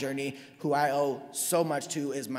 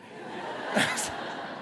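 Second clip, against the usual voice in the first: breathy laugh; 4 dB quieter than usual. Real, not acted. Speaker laughs at own story.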